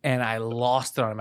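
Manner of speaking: normal talking voice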